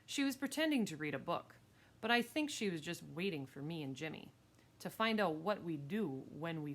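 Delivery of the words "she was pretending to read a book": plain reading voice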